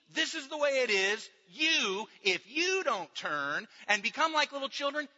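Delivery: loud, driving sermon delivery; preaching at full force. Acted. Pastor hammering the point to his church, full force preaching. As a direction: press it home to the congregation.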